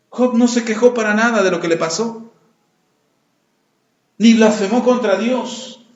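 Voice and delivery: normal talking voice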